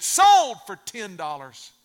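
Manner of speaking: loud, slow, drawn-out declaration; auctioneer's sale call. Acted. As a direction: close the sale with a loud, stretched first word. Auctioneer slams gavel and declares sale.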